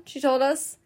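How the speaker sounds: usual speaking voice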